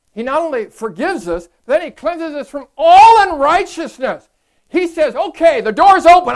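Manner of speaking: high, loud, soaring preacher's cry; preaching at full force. Real, not acted. Pastor to congregation on God's cleansing, preaching at full force. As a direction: lift the room with rising fervor.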